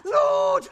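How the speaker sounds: a high, loud pained "Lord"; pained cry. Acted. Nurse moaning to Juliet, high and loud. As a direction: cry out as if the head throbs. Nurse groans about her head.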